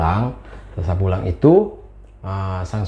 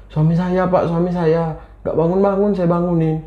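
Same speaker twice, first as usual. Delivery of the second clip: distressed wife; 7 semitones higher than usual. A narrator's reading. Acted wife pleading to neighbourhood head.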